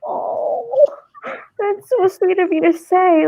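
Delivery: thanking the mom for her kind words, high and touched. Retold or imitated. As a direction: sound genuinely moved. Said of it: "I'm like".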